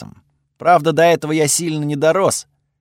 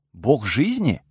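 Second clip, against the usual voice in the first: surprised echo, pitch swooping up on "жизни"; disbelieving question; slower than usual. Acted. Narrator stunned by the news of the deity, disbelieving.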